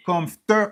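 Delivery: "comforter" with TER pushed up; wrong middle stress. Shown as a demonstration. Teacher showing what not to say, stress on second syllable. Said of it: "Ou pa ka di".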